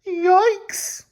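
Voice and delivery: shrill mock alarm, way up high; tiny frightened squeak; high squeaky yikes. Real, not acted. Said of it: "That's how I would be".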